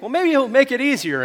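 hopeful thought. Acted. Preacher voices what we sometimes think, hopeful. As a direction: lift it high, as if hoping for an easier way.

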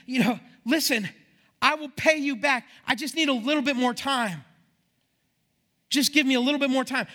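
high, loud begging; urgent pleading. Acted. Debtor on his knees begs for time.

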